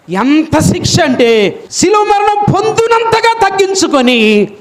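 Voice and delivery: high, shouted sermon climax; preaching at full force; fiery preaching. Acted. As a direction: thunder it over the congregation.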